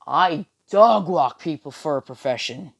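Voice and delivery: playful swaggering declaration; boastful fighter. Acted. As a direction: announce it big, hammed-up. King Lion boasting in story.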